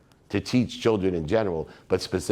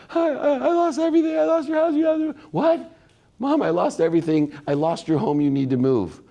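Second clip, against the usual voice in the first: high, sobbing plea to mom; much higher, breaking voice through tears; hysterical crying; 10 semitones higher than usual. Retold or imitated. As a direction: cry it out like a panicked four-year-old. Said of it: "they're hysterically crying".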